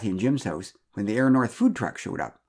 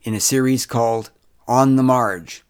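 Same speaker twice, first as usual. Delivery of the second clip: loud, measured closing credits read; projected, careful outro voice; podcast announcer sign-off; 7 dB louder, slower than usual. Acted. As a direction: announce it deliberately, spacing out the web address.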